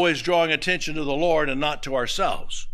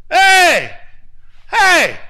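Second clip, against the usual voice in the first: calling out; 12 dB louder than usual. Retold or imitated. Neighbors calling out again.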